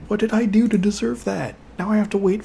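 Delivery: exasperated. Real, not acted. Exasperated at the game.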